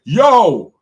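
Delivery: a light mock Jamaican "Yo"; a hint of a Jamaican-accented stone. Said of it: "like a Jamaican accent".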